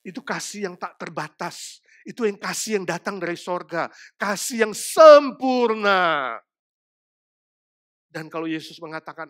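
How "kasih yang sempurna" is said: slow emphasis. Acted.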